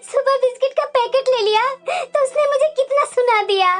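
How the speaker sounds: wailing grievance between sobs; tearful complaint. Acted. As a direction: cry as you complain to your husband. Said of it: "तुम्हारी आंखों में आंसू क्यों है". (रोते-रोते शिकायत करते हुए)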